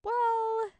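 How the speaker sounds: high, unsure hesitation; hesitant girl. A narrator's reading. Minli hesitating before breaking the news, high and unsure. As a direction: stall before letting him down. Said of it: "Minli said".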